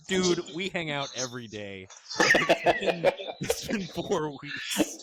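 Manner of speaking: slow, laughing mock complaint; laughing exasperation. Host mocks a forgetful friend, laughing slowly. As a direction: drag it out while cracking up. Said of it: "It's like".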